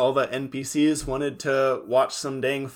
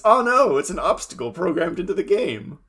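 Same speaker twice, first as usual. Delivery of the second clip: high, loud "oh no" opening; hammed-up dismay at a trivial chore; mock alarm; 6 semitones higher, 3 dB louder than usual. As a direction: announce it like a cartoon hero in peril. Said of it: "I have to do my taxes".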